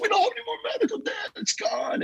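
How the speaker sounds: very high, squeaky blubbering; hammed-up weepy squeal; mock tearful cry. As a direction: imitate crying with relief, voice squeezed up high. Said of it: "And we're crying".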